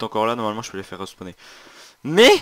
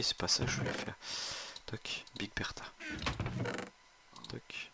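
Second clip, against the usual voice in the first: whispering; 14 dB quieter than usual. Real, not acted. Muttering at game controls.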